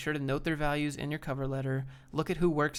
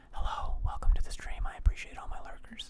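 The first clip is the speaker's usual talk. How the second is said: ASMR whisper. Shown as a demonstration. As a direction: breathe it out like an ASMR video. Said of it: "listening to my A S M R voice".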